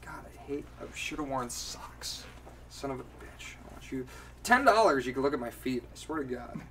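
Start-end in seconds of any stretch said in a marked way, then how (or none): none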